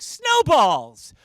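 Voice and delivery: loud, high shouted punchline; imitated schoolboy. Boy delivers riddle answer, loud high kid shout. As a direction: yell it out like a proud kid. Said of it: "he's like".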